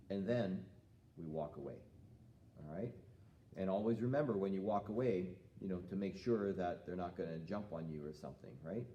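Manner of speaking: normal talking voice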